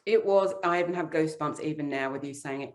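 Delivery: ordinary delivery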